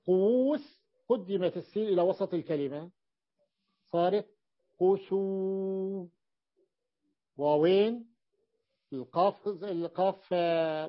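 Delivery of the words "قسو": قسو stretched very slowly, every sound held; teacher drawing out قسو so the class hears its weight; drawn-out demo word. Shown as a demonstration. Teacher to class, spelling out the heavy form slowly. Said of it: "لاحظوا قسو كم هي ثقيلة".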